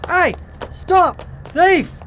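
high, loud cry of alarm; shouting. Acted. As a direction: yell after the fleeing thief. Shopkeeper chases thief.

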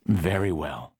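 a tired surrender; resigned. Acted. Reluctantly gives in to daughter.

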